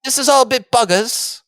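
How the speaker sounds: high-pitched mock British complaint; put-on Englishman, mildly put out; Stephen Grant. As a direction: grumble in a silly English accent. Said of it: "do your best Stephen Grant impression".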